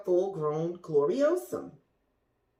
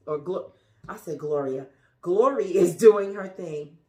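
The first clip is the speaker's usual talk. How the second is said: usual speaking voice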